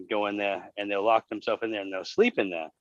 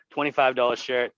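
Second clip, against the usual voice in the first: ordinary talk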